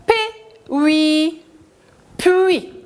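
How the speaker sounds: P, oui, then puis sung out high and falling; blending a syllable. Shown as a demonstration. Teacher building the syllable puis for children. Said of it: "la syllabe".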